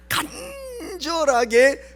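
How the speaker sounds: 간절하게 with a long, slow first syllable; drawn-out emphasis. Shown as a demonstration. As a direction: stretch the start of 간절하게 as in earnest pleading. Draws out word to show earnest pleading.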